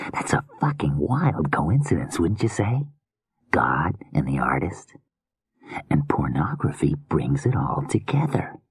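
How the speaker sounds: soft creepy murmuring killer; hushed, unsettling madman musing; menacing whispery killer. Acted. Killer muses on art.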